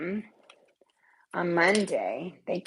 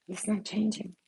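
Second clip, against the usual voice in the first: soft whisper; 7 dB quieter than usual. Real, not acted. Telling viewers her ex stays family, in a soft whisper. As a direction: let it fall to a private whisper.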